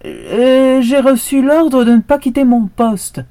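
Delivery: high, loud desperate plea; desperate dog. A narrator's reading. The cornered dog pleads loudly with the horse. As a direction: plead with all he has.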